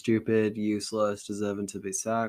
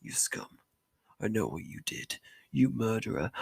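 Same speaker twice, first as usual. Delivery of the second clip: quiet seething accusation; hushed menace; 6 dB quieter than usual. Acted. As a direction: hiss the accusation under breath. Mother confronts Spite.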